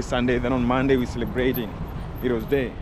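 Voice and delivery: usual speaking voice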